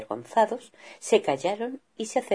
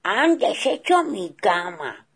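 mock angry yell; 5 semitones higher, 4 dB louder, slower than usual. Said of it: "papá oso gritó".

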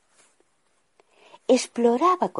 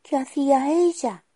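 higher, small timid question; princess asking; 12 semitones higher than usual. Acted. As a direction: ask from inside the glass. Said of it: "preguntó Adelina".

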